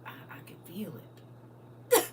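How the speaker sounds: slow, whispered, half-sobbing; mock crying whisper. Real, not acted. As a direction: pretend to weep over the food. Overcome by food memory.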